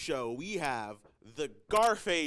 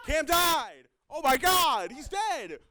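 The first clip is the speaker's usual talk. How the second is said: loud, high hammed-up alarm; mock shock; 11 semitones higher, 9 dB louder than usual. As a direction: shout it in hammy horror. Mock shock at Cam's death.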